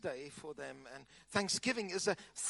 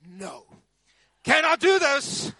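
a sharp, raised, slowed protest; indignant refusal; 7 semitones higher, 8 dB louder, slower than usual. Retold or imitated. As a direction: refuse him, loud and indignant. Pushing off the masseur, walking out.

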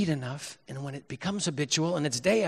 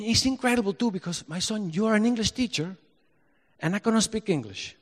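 put-on higher-pitched Spanish-accented father; 6 semitones higher than usual. Father to his English-teacher son, Spanish accent. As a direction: imitate Dad marvelling in a Spanish accent. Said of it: "he would say in his Spanish accent".

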